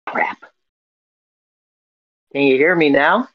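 normal talking voice

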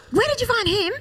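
high-pitched startled question; alarmed surprise. Retold or imitated. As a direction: act out a mother caught off guard. Mother reacting to unexpected find.

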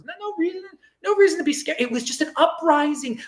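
high, mock-innocent reassurance; sarcastic mockery. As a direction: pretend nothing is wrong, dripping irony. Sarcastic mock of riot denial.

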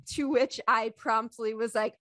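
normal talking voice

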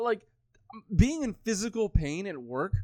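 ordinary talk, nothing marked

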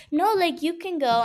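lightly voiced girl politely giving up her turn; kind classmate. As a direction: pitch it up to offer the turn.

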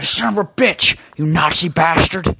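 loud, high furious yell; rage scream. Acted. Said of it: "he screams out of rage".

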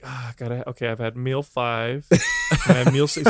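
slow, soft daydreaming voice; mock dreamy Adam. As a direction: drift off lazily. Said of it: "He's like".

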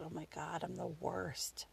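very soft, self-reproaching murmur; guilty hushed aside. Retold or imitated. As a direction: think it aloud, barely audible. Guilty thought, stopped in her tracks.

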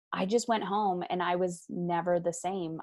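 ordinary delivery

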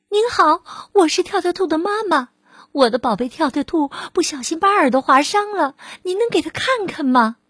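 high, hurried worried mother rabbit; anxious mother. Acted. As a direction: plead urgently for her child. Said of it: "跳跳兔妈妈焦急地说".

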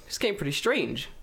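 high, fast, altered musing voice; high voice wondering about the package; put-on puzzled voice. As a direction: play a fussy, puzzled self. Checking email, growing suspicious.

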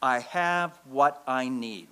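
slow, deliberate, each word weighed; slow mantra. Shown as a demonstration. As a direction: lay it down like a creed. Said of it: "teaches us to say back to our feelings five words".

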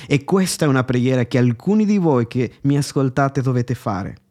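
ordinary delivery

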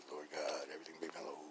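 low whispered mock prayer; hushed prayer. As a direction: whisper it down into near silence. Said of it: "I was praying that".

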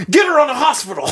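high, fast mock emergency call; urgent order. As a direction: rattle it out like a panicked order. Acted emergency order in a story.

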